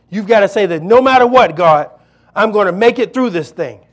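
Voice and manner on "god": ordinary talk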